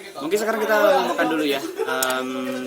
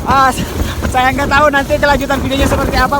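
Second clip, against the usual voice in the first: raised voice over a storm; shouting over wind; 4 semitones higher, 4 dB louder than usual. Real, not acted. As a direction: call out over howling wind. Holding tent in strong wind.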